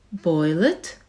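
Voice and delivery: gasped. Acted. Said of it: "gasped Horton".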